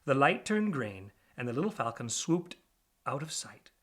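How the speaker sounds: ordinary delivery, nothing marked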